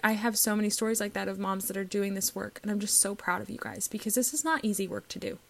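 normal talking voice

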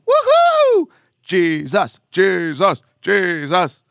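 hammed-up fan greeting a hero; mock cheering. Preacher imitating believers greeting Jesus, mock cheer.

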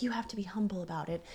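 quick, soft whispered inner voice. Retold or imitated. Her inner voice telling her to stay humble, whispered. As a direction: whisper it like a thought in her head. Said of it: "that in my head of".

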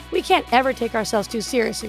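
ordinary talk, nothing marked

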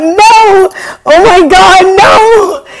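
very high, loud wail of refusal; retold scream of refusal; panicked shout. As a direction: shriek it in hammed-up terror. Retold refusal atop the platform.

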